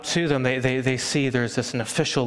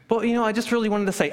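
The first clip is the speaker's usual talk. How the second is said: eager rush; 8 semitones higher, faster than usual. Retold or imitated. Eager believer talks fast.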